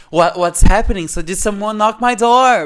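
hammed-up alarm; confused contestant. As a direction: act startled by a knock at the door. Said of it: "everyone seems so like".

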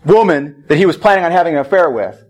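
ordinary talk

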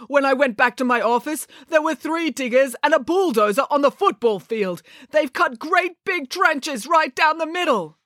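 put-on high, outraged amateur Russian accent; bad Russian accent. A narrator's reading. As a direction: report the damage in an indignant Russian accent. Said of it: "I'm very bad at Russian accents".

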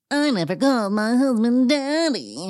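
lightly voiced haughty Erica; a hint of prim denial; mocking Erica. Host mocking Erica's confessional denial, lightly voiced.

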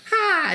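high sudden shriek; a sharp high yelp; alien shriek. Retold or imitated. Alien imitated again.

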